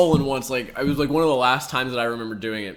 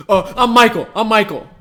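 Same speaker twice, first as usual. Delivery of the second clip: mocking a dude; 8 semitones higher, 9 dB louder than usual. Mocking the guy introducing himself.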